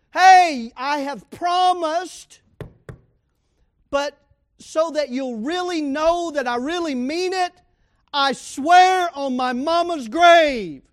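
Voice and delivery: booming mock oath on your mama's grave; emphatic oath. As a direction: swear it like you need them to believe you. Acting out a swearing oath.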